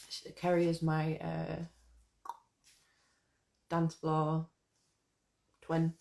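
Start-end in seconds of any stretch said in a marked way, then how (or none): none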